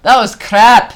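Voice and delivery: high, loud Scottish outburst; mock Scottish accent. Comic judges their show, loud mock Scottish. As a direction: blurt it in a put-on Scottish accent.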